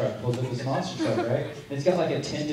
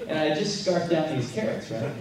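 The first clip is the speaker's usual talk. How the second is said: ordinary talk, nothing marked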